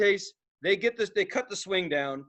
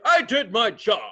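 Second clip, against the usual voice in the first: a hint of a smug firefighter; 7 dB louder than usual. Firefighter's proud mock line.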